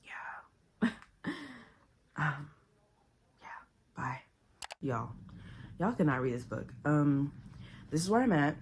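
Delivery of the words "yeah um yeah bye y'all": soft whispered yeah. Real, not acted. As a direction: whisper it to yourself. Whispered aside.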